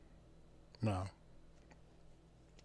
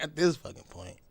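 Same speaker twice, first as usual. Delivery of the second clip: ordinary talk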